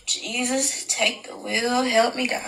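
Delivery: softly muttered plea to heaven, worn out; exasperated aside. Real, not acted. Overwhelmed by the story, quietly begging God. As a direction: breathe it out under your breath, defeated.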